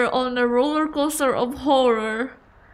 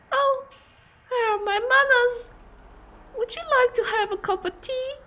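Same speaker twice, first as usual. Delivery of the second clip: high-pitched granny playing hostess; courteous granny, pitched way up; polite old lady; 13 semitones higher than usual. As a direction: offer hospitality in a high old woman's voice.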